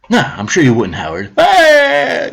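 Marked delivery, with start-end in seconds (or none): 1.4-2.3 high, sustained mock scream; mock outraged scream. Host mimicking Howard's outrage at corruption, mock screaming. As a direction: imitate a screaming protest at a much higher pitch. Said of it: "You wouldn't be screaming".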